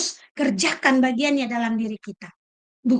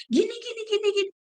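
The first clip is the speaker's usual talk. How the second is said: higher, mocking imitation of gossiping tongues; mock gossip chatter; 8 semitones higher, 4 dB quieter, faster than usual. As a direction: lightly mimic a gossip's babble. Mimicking gossipers' chatter again. (tiruan orang bergosip, nada tinggi mengejek)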